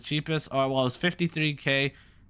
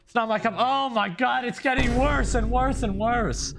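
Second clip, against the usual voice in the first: disgusted; 8 semitones higher than usual. Real, not acted. Streamer reacting to an ugly car, high and appalled. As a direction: recoil at each worse picture.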